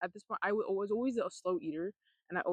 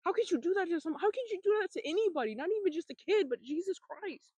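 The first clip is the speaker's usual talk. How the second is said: real upset, voice pitched high; getting emotional; 9 semitones higher than usual. Real, not acted. Recalling being hit as a child, emotional. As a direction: plead in high-pitched, pained disbelief. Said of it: "I'm getting emotional right now".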